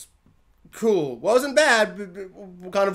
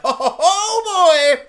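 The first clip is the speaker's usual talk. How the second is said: mock delighted chuckle; 10 semitones higher, 7 dB louder, much slower than usual. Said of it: "and you're like".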